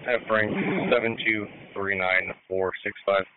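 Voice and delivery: normal talking voice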